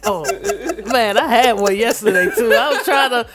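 loud, high, laughing while telling; story broken by laughter. Real, not acted. Laughing retelling dead lighter.

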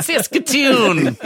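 slow, high stretched Saskatoon; drawn-out singsong. Retold or imitated. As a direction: sing the name out slowly. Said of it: "We couldn't stop going".

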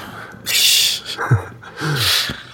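breathy, high, unvoiced laugh; a quick airy laugh. Real, not acted. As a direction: laugh it off at the friend's jab. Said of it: "We all know that".

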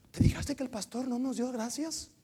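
high-pitched gossipy grumble; mock complaint. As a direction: imitate an offended church member, high-pitched. Voices a complaining church member. (queja fingida en voz aguda)

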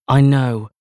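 put-on smug posh girl; self-satisfied and loud; smug cousin. A narrator's reading.

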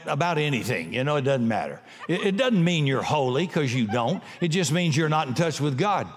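ordinary talk

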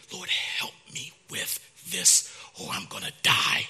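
a hushed, broken plea alone with God; whispered plea. Acted. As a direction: whisper it like a last desperate prayer. Desperate prayer in private.

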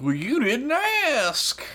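drawn-out high quip; high slow voice. Acted. Shapeshifter's deadpan reply, acted.

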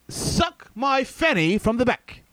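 slow, deliberate mock British accent; put-on British delivery, each word drawn out; John Oliver.

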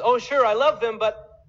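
high, loud, insincere sweetness; a laughing, hammed-up fake assurance; mock fake love. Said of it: "Loving at a distance says".

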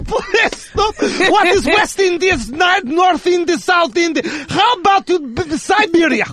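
Russian dad scolding. Pretend. Russian dad character continues.

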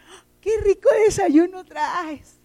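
high, slow, delighted exclamation; young daughter marveling at a classmate's lunch, excited; excited child. Retold or imitated. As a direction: gush over the breakfast like a little girl. Daughter admiring boy's breakfast.

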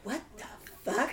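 slow, hushed what the fuck; whispered disbelief. Retold or imitated. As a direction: breathe it out in shock. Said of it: "I was like".

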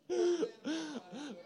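real high-pitched laughter; high burst of laughter. Real, not acted. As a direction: crack up helplessly. Everyone cracks up at the joke.

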